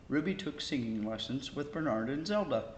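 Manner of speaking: usual speaking voice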